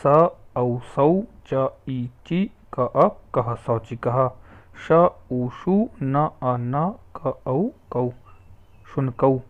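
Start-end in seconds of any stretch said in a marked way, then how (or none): 0.1-3.6 each letter sounded out slowly, one by one; syllable by syllable, unhurried; slow spelling out. Shown as a demonstration. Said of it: "वैसे ही". 4.9-8.1 each letter sounded out slowly, one by one; syllable by syllable, unhurried; slow spelling out. Shown as a demonstration. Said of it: "वैसे ही".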